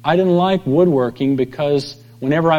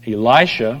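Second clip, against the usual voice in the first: ordinary delivery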